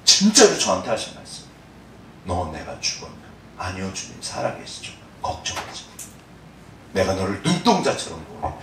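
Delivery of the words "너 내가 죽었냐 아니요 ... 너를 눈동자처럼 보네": 속삭이듯 재연. Retold or imitated. 하나님과의 대화를 속삭이듯 재연.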